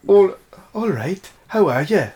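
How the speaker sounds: put-on lilting Welsh accent, pitched high. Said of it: "How's your Welsh accent?".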